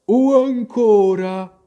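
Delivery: loud, high, drawn-out sleepy voice; sleep-talking dormouse. A narrator's reading. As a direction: talk slowly in your sleep, pitched high. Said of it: "sembrava parlare nel sonno". (ghiro che parla nel sonno)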